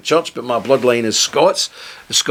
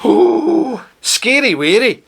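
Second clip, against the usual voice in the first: mock fright; 9 semitones higher, 4 dB louder, much slower than usual. Mocking fear of the truth.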